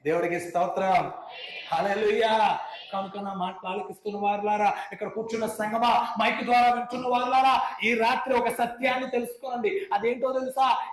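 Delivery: loud, high, slow preaching call; rally the whole crowd; full-force preaching. Acted. (పూర్తి శక్తితో బోధించడం)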